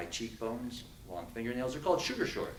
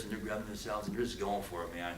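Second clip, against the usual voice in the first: usual speaking voice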